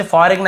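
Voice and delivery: फॉरेन misread as "fo-reg-n"; G wrongly sounded. Shown as a demonstration. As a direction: put a hard G before the final N of फॉरेन. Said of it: "ऐसे बोल देते हैं".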